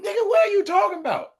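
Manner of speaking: very high-pitched, disbelieving comeback; mock incredulity. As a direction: challenge them in a high, incredulous squeal. Acted comeback to the catchphrase.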